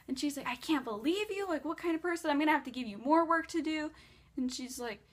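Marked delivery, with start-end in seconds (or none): 0.5-3.9 higher-pitched mock scolding boss; retold scolding. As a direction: act out an indignant telling-off. Boss scolding her again.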